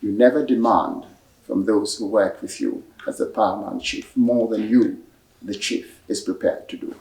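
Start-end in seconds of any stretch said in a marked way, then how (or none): none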